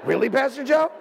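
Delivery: a light imitation of sarcastic reproach; mocking reproach. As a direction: chide him with a sing-song rise. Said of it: "it's like".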